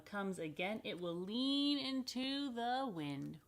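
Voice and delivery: put-on picture book read to kids; reading to children. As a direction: read it gently and wide-eyed.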